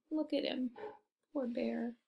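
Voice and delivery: plain reading voice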